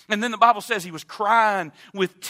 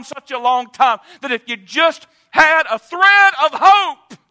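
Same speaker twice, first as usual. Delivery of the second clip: full-force preaching; 7 semitones higher, 11 dB louder than usual. Acted. Preacher urging the congregation to hold on to hope, loud and high. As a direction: shout it over the congregation.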